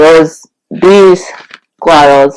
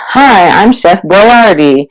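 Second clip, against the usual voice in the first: put-on Boyardee with the stress on AR, slower and higher; stress on middle of Boyardee; 4 semitones higher, slower than usual. Speaker imitating Chef Boyardee in an old commercial, stress on AR. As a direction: lift the middle syllable of Boyardee, unhurried. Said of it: "and he says".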